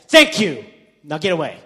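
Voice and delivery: loud, exasperated mock thanks; annoyed dismissal. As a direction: send him away, fed up and loud. Acted annoyed dismissal of Ian.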